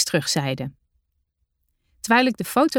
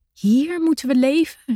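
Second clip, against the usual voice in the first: disbelieving cry; 7 semitones higher than usual. A narrator's reading. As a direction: cry out, stunned by the bare island. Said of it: "riep ik vol ongeloof uit". (ongelovig uitgeroepen, hoog en luider)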